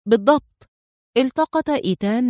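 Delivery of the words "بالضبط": mildly stern confirmation; understated firm answer. A narrator's reading. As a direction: confirm it firmly in one word. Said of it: "أجابه في صرامة".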